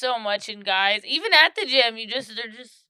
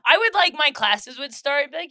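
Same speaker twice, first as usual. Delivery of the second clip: ordinary talk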